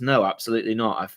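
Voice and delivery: ordinary talk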